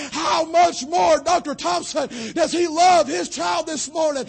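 high, loud, rising pulpit shout; preaching at full force. Acted. Preacher shouting his faith to the congregation. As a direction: build it like a revival climax. Climax of the sermon.

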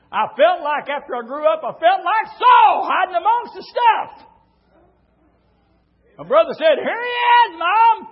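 loud, high preacher's cry; preaching at full force. Acted. Preacher shouting the story to his congregation.

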